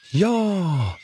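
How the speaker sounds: high, eager shout of yes; children shouting in chorus. A narrator's reading. Children calling out to Brummelisa in one eager shout. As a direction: cry out together like excited kids. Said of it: "ropar barnen i kör".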